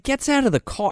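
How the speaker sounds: normal talking voice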